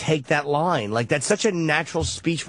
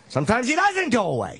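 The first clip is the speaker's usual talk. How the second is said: hammed-up old sea dog, pitched up; overdone Quint; 7 semitones higher than usual. Shown as a demonstration. Hosts mocking Quint's shark line, hammed-up sea dog. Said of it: "I would do this wrong".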